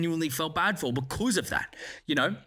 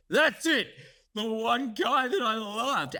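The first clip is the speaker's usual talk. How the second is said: mock anguished Cad; 5 semitones higher, slower than usual. Voicing Cad's grief and rage.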